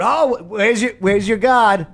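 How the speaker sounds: high-pitched taunting singsong; mocking Elijah. Elijah jeering at the prophets of Baal, high-pitched mockery. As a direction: taunt them in a put-on high voice. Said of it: "Elijah was mocking them all".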